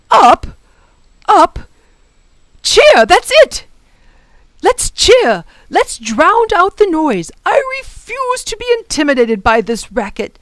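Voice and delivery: loud, high rallying professor; rally everyone over the racket; rousing shout. Acted. Professor rallies children over the din.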